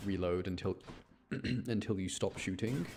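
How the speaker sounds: ordinary talk, nothing marked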